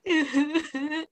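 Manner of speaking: high, loud burst of laughter; host recalling therapy, high loud laugh. Real, not acted. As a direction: let a loud giggle spill out. Laughing after emotional session.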